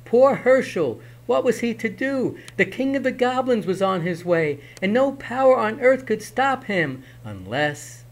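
high, urgent storyteller; dramatic narration. A narrator's reading. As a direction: heighten the danger.

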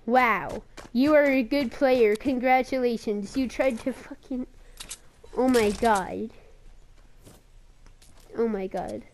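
loud, mocking outrage at a cheap kill; furious sarcastic yelling. Real, not acted. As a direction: shout your fake congratulations, seething. Opponent kills him with rocket launcher.

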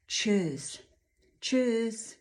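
"tschüss" as the German goodbye. Shown as a demonstration. Teacher borrows the German goodbye for Tuesday, German pronunciation. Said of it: "Prawie jak w niemieckim cześć na pożegnanie".

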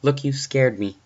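high, loud, frightened confrontation; shaken protest. Acted. Babysitter telling the prank caller he scared her, agitated. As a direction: push back in alarm.

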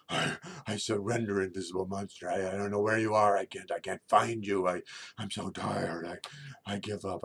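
mock-exhausted surrender, halting; tired giant moose. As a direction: give up loudly with stammering weariness. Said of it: "I'm so tired and everything".

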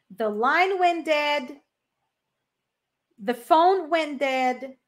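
very slow, clear model; very slow repeat. Shown as a demonstration. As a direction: drag the words out. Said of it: "De novo". (repetição bem lenta)

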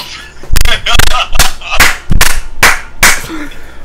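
loud breathy cackle; loud laugh. Real, not acted. Laughing hard after his friend's joke. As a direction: break into a big laugh.

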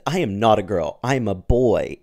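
put-on boy insisting loudly. A narrator's reading. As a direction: push it out as a firm denial, not high. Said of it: "in a gentle, high-pitched voice".

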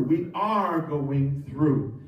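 slow, drawn-out ARE, then "going through" settles; stress on ARE. Shown as a demonstration. Preacher restating the title for the church, stress on ARE. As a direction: lean long and firmly on ARE. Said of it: "The emphasis is not on what we're in".

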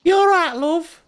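high, loud mock British voice; mock English. Streamer parodying English chap hop lyrics, high cheery mock English. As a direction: chirp it like a cheery Brit. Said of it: "It's about tea".

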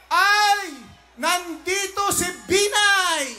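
high, loud, drawn-out cry of recognition; imitated shout. Candidate retells a stranger spotting "Binay", shouting. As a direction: call out like an excited onlooker. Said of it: "ay may sumigaw".

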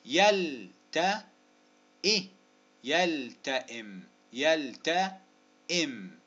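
"يلتئم" stretched very slowly in parts; slow, deliberate sounding-out of "يلتئم"; syllable-by-syllable. Shown as a demonstration. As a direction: separate the syllables of "يلتئم" and hold each. Sounding out word for spelling.

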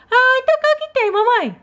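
put-on high childlike voice of a small daughter; little girl voice. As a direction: play the sick little girl talking to mom. Doll asks.

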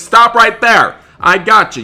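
loud, high yell at the runner; loud shout. Pretend. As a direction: yell as you grab him. Deputy catches fleeing suspect.

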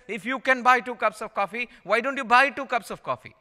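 lightly voiced Italian counter guy; pushy counter guy. Italian counter guy pressing a sale.

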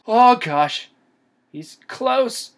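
loud, high burst of panic; startled alarm. Real, not acted. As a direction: blurt it out as the monster appears. Monster suddenly appears close in game.

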